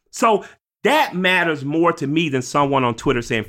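normal talking voice

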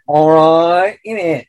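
Cockney pigeon. Acted. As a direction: play a London pigeon with a Cockney accent.

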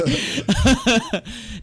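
loud squealing laugh; high helpless laughter; high burst of laughter. Real, not acted. Continued laughing.

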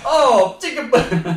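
put-on fumbling for a French accent; breaking up while trying an accent; botched mock accent. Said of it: "what's a French accent?".

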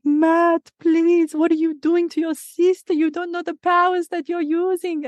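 high-pitched, pleading mum voice; worried mum. Retold or imitated. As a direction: imitate a mother anxiously begging her son to stop. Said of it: "My mum's worried".

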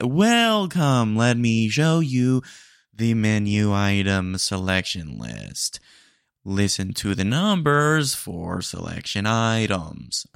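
slow, loud automated menu read; loud, slow AI assistant voice; robot drive-thru voice. Acted. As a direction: drone the menu like a machine. AI drive-thru bot reads menu.

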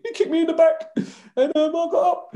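put-on high, small childish voice; little kid voice. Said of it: "like a little kid".

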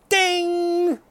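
high sung ding; mock game show ding; ding chime. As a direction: imitate the correct-answer bell. Game show correct answer chime.

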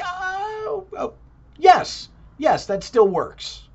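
high excited oh oh yes yes, slowing at the end; mock relieved delight. Imitating his own relief when Ukraine and Russia were named, high gush. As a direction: gush with relief, then settle. Retold relief at new consecration.

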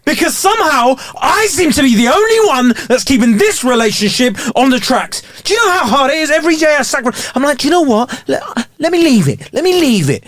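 high-pitched melodramatic rant; soap opera tirade. Acted. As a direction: pour out grievances, high and overwrought. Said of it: "soap opera way".

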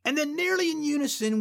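ordinary talk, nothing marked

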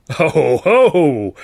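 loud, slow, mocking ho ho ho; nasty bellowing laugh. Manager sneering at the old man, nasty bellow. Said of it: "Carno bellowed nastily".

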